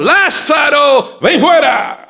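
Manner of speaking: high, slow, booming command; commanding shout. Shown as a demonstration. Jesus calling Lazarus from the tomb, booming. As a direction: thunder the call to raise the dead. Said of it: "Ay, Padre, esa voz, Dios mío, retumbó allí".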